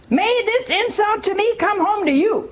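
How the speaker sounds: high, scolding wife's curse; high accusing wife. Retold or imitated. Wife curses her husband, high and scolding.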